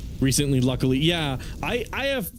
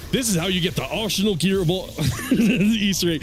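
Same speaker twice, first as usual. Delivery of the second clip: projected, higher hype voice breaking into laughter; YouTuber voice; 5 semitones higher than usual. Shown as a demonstration. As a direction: sell it like a clickbait host, laughing. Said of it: "I don't like doing the YouTuber voice".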